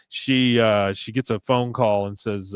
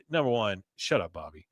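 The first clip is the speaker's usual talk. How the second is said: normal talking voice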